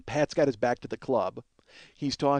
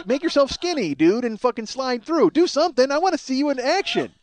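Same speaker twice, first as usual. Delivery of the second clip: high-pitched, insistent goading; exasperated urging; 9 semitones higher, 4 dB louder than usual. Acted. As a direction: egg a friend on, pitch climbing. Acts out urging Pat on.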